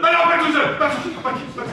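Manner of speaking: high, loud outburst of protest; panicked protest. Acted. Brother threatened with being left all alone, panicked protest. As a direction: plead loudly not to be left alone.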